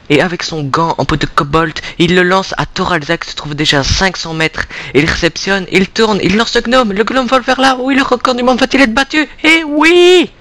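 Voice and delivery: rising excited sports call; play-by-play commentary. Acted. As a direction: build to a high roaring climax. Live gnome throw commentary.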